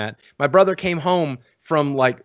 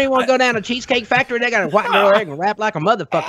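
put-on fast, a bit higher Southern good ol' boy; lightly Southern, folksy pitch; Southern accent; 5 semitones higher than usual. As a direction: hype up the Cheesecake Factory in a Southern accent. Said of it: "doing Yoda and Gollum".

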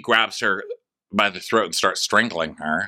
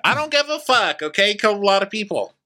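exasperated rant; 11 semitones higher than usual. Real, not acted. Ranting about the character.

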